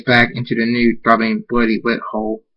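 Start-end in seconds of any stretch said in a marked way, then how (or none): none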